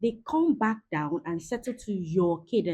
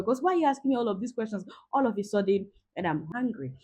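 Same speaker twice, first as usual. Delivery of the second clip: ordinary delivery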